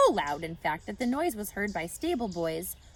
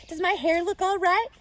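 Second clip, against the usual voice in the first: fussing wife; 10 semitones higher, 7 dB louder than usual. A narrator's reading. Mrs Zuckerman asks anxiously.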